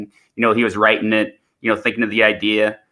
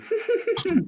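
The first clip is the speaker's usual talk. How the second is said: high giggle; 6 dB quieter than usual. Acted. Doctor laughs in his own scene.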